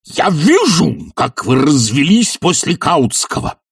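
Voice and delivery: high, drawn-out angry screech; shrill cry. Acted. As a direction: screech it shrilly, stretching the start. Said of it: "визгливо и пожелтев крикнул".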